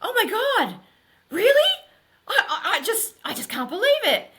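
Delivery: husband hearing wonderful news on the phone, shouting with joy; stunned joy. Retold or imitated. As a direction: yell it out like you've just won everything. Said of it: "I could hear him go".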